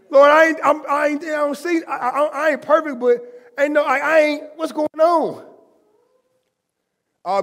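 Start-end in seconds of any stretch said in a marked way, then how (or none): 0.1-5.4 high, stammering plea to God; distressed prayer; desperate pleading prayer. Retold or imitated. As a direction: cry out to the Lord, lost for words. Retelling his own desperate prayer.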